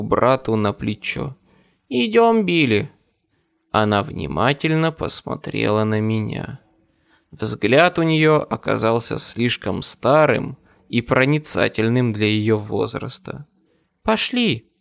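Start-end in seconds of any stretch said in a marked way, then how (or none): 1.9-2.9 put-on high-pitched child urging someone along; young girl's voice. A narrator's reading. Sister leads her little brother away, high girlish voice. 14.0-14.7 put-on short, high-pitched child's urging; a girl's high call to go; young girl's voice. A narrator's reading. Sister hurries her brother out, high girlish voice.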